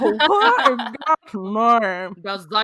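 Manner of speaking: loud, hammed-up Louisiana outburst; Louisiana accent. Fan joking about own Hank voice, exaggerated Louisiana accent. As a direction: overdo the Louisiana accent, punching it out. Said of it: "a Louisiana accent".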